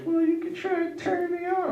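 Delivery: put-on high, laughing girly voice; mock girlfriend falsetto. Comedian voices his girlfriend inviting him, high laughing falsetto. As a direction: camp up the girlfriend's coy line.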